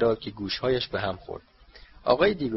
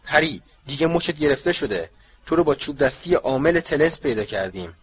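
loud, higher accusing shout; Diggory shouting at the elf, triumphant; 5 semitones higher, 7 dB louder than usual. Acted. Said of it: "آقای دیگوری فریاد زد".

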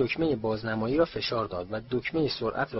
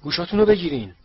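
louder, higher urgent shout; Mr Weasley shouting; 5 semitones higher, 8 dB louder, faster than usual. A narrator's reading. Father warning the others at the match, shouting. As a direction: yell a warning. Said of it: "آقای ویزلی فریاد زد".

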